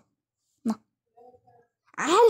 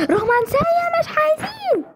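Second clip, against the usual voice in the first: mock whining. Teasing the romantic couple.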